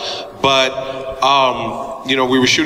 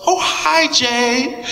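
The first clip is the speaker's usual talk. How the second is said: voicing Halle; 11 semitones higher, much slower than usual. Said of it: "she goes".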